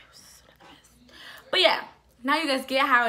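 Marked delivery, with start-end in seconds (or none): 0.1-1.2 barely audible whisper; whispered aside. Real, not acted. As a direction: say it under your breath. Aside to viewers under breath.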